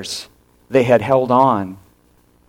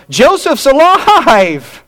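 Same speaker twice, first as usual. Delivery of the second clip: much higher, louder, slow ecstatic "Joseph's alive!"; joyful cry; 13 semitones higher, 6 dB louder, much slower than usual. Retold or imitated. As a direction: shout it out in stunned joy, drawing it out. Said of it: "on one hand, they're going".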